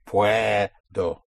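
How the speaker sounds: "Puedo" with PUE held long; push down, surface. Shown as a demonstration. Teacher demonstrating the push, "Puedo" stretched on PUE, then a separate O. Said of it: "After you push down, you come up on O".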